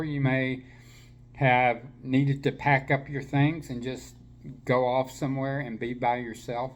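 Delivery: usual speaking voice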